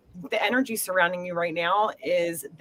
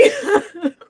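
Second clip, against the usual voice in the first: high, louder tearful laugh; teary laugh; 11 semitones higher, 5 dB louder than usual. Real, not acted. Mother breaks into a high teary laugh after grandfather's message. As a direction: laugh to cover the tears. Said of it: "a lot of emotion come through that".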